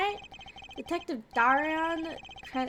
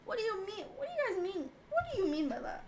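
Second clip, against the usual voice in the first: put-on British accent; 6 semitones higher, 5 dB quieter than usual. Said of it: "you're in your British mood?".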